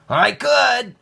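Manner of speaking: put-on high, loud, firm boy's insistence; firmly. A narrator's reading. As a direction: plant your feet and insist. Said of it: "said Nicholas firmly".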